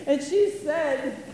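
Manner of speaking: normal talking voice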